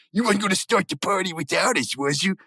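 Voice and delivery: a lightly done Muscle Man teasing. Retold or imitated. Reviewer voicing Muscle Man teasing the band, cocky and high. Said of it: "He goes".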